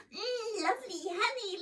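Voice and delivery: put-on high, cooing baby voice savouring honey; delighted baby coo. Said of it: "the sticky baby was crying out".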